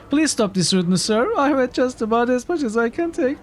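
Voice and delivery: high voice cracking into laughter; laughing through line. Acted. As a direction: lose it mid-line and giggle. Hero voice breaks into laugh.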